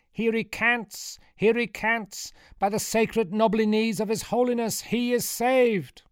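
high, drawn-out proclamation; priest's grand announcement. A narrator's reading. Priest announcing a miracle to his flock, high and theatrical. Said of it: "turned to his flock and announced".

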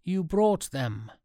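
high, drawn-out astonished gasp; slow, high-pitched wonder; gasping amazement. A narrator's reading. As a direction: say it as if you can hardly believe it. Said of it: "Powell gasped".

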